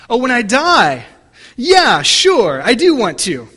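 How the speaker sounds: put-on teen suddenly getting it, "Yeah, sure"; relieved boy. Boy to pastor, relieved realization. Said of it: "the young boy replied".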